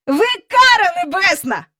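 put-on high-pitched child character; eager boy. A narrator's reading. Boy greeting the visitor by her nickname, eager. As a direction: blurt it out in a high voice.

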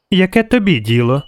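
put-on defiant high child voice; sniffy little girl. A narrator's reading. Girl brushes off the witcher's question, high defiant voice. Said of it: "шморгнула вона".

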